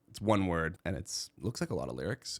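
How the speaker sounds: usual speaking voice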